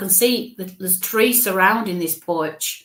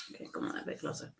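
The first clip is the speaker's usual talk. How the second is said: very soft, unvoiced murmur under the breath; hushed aside; 18 dB quieter, much faster than usual. Real, not acted. Medium coaxing the spirit to step closer, hushed aside. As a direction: coax quietly, barely audible.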